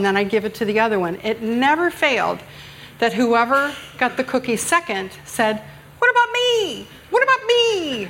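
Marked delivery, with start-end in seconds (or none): 6.0-8.1 put-on very high kid whine, "me" stretched out; whiny child's complaint. Mother voicing her twin who got the cookie second, high whine. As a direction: protest like the twin served second. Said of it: "everybody say it like that".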